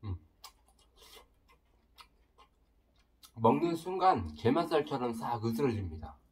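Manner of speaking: chewing between words; tags: talking while chewing. Real, not acted. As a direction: mouth full of food. Seen 0: Puts tofu skin in mouth at -3; chews with closed lips throughout.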